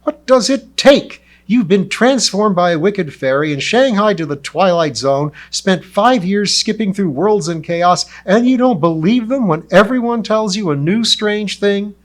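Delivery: loud, high, exasperated shouting; yelling in disbelief; furious rant. Acted. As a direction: berate him at full volume.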